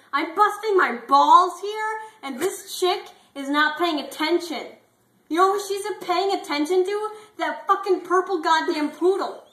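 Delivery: high, loud, outraged yelling; furious rant. Acted. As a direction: blow up at the unfairness. Bulldog rants to his friend.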